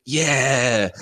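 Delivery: loud, high, drawn-out imitated cheer; enthusiastic crowd cheer. Podcaster imitating the crowd's answer, enthusiastic cheer. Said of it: "They're so enthusiastic about it".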